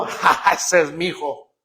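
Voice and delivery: laughing proudly, lightly imitated; a dad chuckling with pride. Father laughing at his son copying him. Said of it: "los papás, cuando ven".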